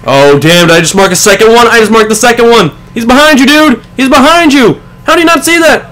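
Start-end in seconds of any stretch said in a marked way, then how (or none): 2.9-5.9 urgent, higher shouting at the screen; frantic, trying to warn him in time; yelling warning. Real, not acted.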